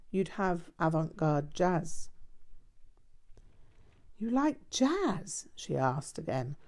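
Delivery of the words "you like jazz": higher, slow, doubtful question; incredulous. A narrator's reading. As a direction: ask slowly, not believing it. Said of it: "with some degree of incredulity".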